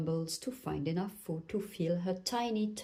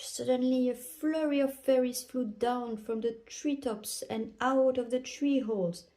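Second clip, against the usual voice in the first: ordinary delivery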